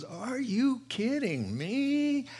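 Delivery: high, slow incredulous drawl; mock TV host disbelief; a hammed-up Doctor Phil; Dr. Phil impression. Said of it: "he goes".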